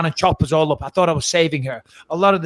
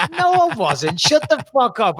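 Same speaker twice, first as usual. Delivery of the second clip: higher, half-joking brush-off; mock indignant denial; 7 semitones higher, slower than usual. To colleagues on the plane, a higher mock-indignant denial. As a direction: toss it back like a mock-offended denial. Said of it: "I'm like".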